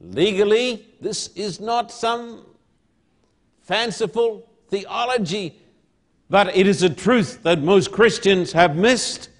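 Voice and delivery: slow, loud, weighty pulpit oratory; emphatic, drawn-out sermon proclamation; full-force preaching. Real, not acted. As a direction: drive each phrase home to the congregation.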